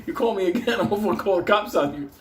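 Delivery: high, laughing, exasperated rant; an exasperated broker shouts at a client who keeps calling; fed-up broker. Retold or imitated. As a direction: yell like a broker sick of night calls. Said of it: "He'll be like".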